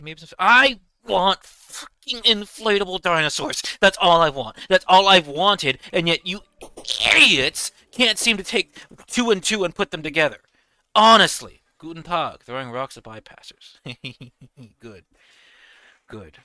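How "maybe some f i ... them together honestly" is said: much higher, louder frustrated outburst; exasperated rant. Real, not acted. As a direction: vent at the audience in half-joking fury. Venting frustration at audience.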